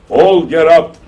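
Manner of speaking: loud, high command; commanding shout. Retold or imitated. Sheikh ordering the dead in the cemetery to rise, shouting. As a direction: call the dead up with authority.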